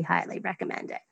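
soft, breathy whispered recommendation. Real, not acted. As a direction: lean in and whisper it like a secret. Emphatic personal book recommendation.

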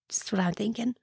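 soft unvoiced whisper; whispered aside. Real, not acted. Host confiding her own view to listeners, whispered. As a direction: lean in and whisper it like a secret. Aside after opinion on humiliation.

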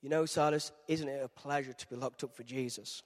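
gently cheerful saint; lightly voiced content preacher; serene Paul. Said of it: "Paul goes".

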